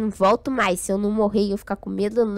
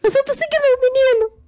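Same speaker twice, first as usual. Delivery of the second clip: panicked; 14 semitones higher, 6 dB louder, faster than usual. Real, not acted. Panicking in game.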